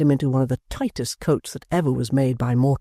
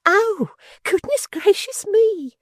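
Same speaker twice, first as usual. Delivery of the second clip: squealing maid; 5 dB louder, slower than usual. A narrator's reading. Maid Miggs exclaiming.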